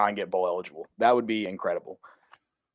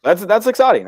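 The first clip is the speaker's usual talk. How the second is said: much higher and louder, genuinely thrilled; excited; 10 semitones higher, 9 dB louder than usual. Real, not acted. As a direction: redo it with real enthusiasm. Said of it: "I just said how exciting that was".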